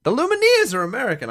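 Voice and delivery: astonished loud exclamation; surprised outcry. Real, not acted. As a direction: shout it in shock. Surprised quiz reveal.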